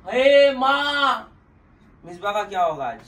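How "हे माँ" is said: very slow, high, loud drawn-out "हे माँ"; mock shocked cry. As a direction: act out the shock of the missing carpet. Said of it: "सबसे पहले मैं ऐसा करा". (नकली हैरानी से "हे माँ")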